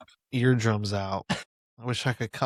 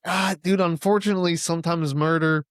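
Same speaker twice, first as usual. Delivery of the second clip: excited; 6 semitones higher, 4 dB louder than usual. Retold or imitated. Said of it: "I'm like".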